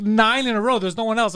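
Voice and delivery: ordinary talk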